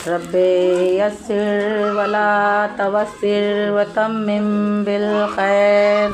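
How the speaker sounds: slow, low, drawn-out Arabic recitation; Quran recitation. Acted. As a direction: intone the verses slowly and low. (धीमा, गहरा क़ुरआनी तिलावत का स्वर)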